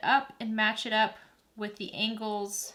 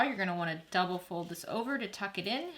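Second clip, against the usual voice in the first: ordinary talk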